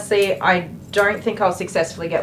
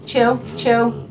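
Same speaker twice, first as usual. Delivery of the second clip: usual speaking voice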